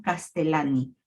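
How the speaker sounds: "Castellani" with a single L sound, cas-te-LA-ni; double L as one. Shown as a demonstration. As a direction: say "Castellani" with a plain L, not a Y sound. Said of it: "pronuncia como solamente una".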